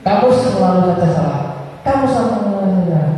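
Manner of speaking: high, quick teacher's reprimand; acted punisher's quick telling-off; rapid scolding. Shown as a demonstration. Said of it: "Saking cepatnya tidak terdengar".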